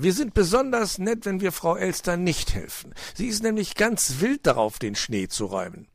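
high, lively coaxing; persuading fox uncle. A narrator's reading. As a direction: talk the child out of it.

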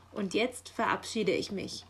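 usual speaking voice

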